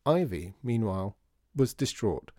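ordinary delivery

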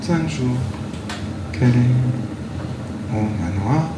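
very slow, drawn-out and eerie; slow eerie delivery. Shown as a demonstration. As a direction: stretch it out like a ghost story. Slow eerie repeat for sound design.

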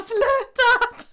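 loud, very high wailing stop; exasperated shout. Retold or imitated. Girl yelling at the guys who call her vanilla, loud high shriek. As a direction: shriek it, fed up.

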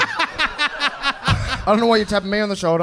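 slow, laughing mock protest; laughing while protesting.

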